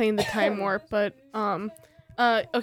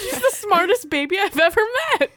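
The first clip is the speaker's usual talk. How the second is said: excited; 10 semitones higher, 3 dB louder than usual. Real, not acted. Laughing high exclaim.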